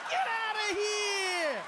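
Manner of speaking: long drawn-out high shout; a crowd's stunned cry stretched out. Retold or imitated. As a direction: whoop it out in a stretched falsetto. Crowd reaction impression.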